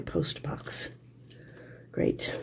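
coaxing whisper. Real, not acted. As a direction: whisper it to the piece. Coaxing the stuck piece.

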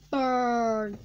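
loud cartoon shout. Toy stunt, shouted.

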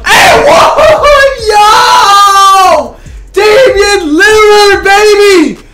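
very high, loud, strained yells; screaming. Real, not acted. Gamer screaming his star's name after the play, high and loud. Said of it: "I'm sorry if I'm screaming".

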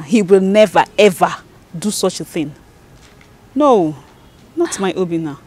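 loud, emphatic never ever; insisting loudly. Acted. Woman to her friend, insisting loudly he would never. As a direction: defend him hard, punching never and ever.

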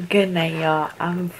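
sleepy, soft and low; drowsy, winding down; tired. Real, not acted. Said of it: "I'm fucking tired".

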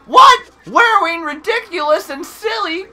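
high, loud cartoon exclaiming; peppy character. Player voicing the game tutor, peppy high voice. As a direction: punch the words out high and peppy. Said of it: "a little bit more peppy".

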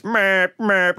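bus horn imitation. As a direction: blare it like the noisiest bus horn. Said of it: "the bus goes".

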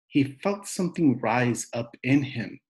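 plain reading voice

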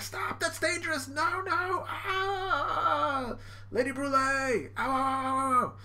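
high-pitched wailing alarm; hammed-up frantic cries; mock panic. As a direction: wail like a cartoon villain in trouble. Brulee and henchmen panicking.

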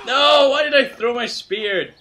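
loud, high self-reproach; dismayed yell. Real, not acted. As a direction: shout in regret at your mistake. Blunder in the game.

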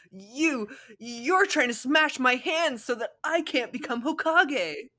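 high, slow, outraged yelling; indignant accusation. Acted. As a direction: accuse him, wounded and loud. Naruto accusing Shikamaru.

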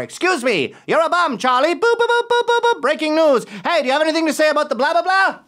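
high, rapid-fire mock newshound; pushy reporter. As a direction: badger someone like a caricature reporter.

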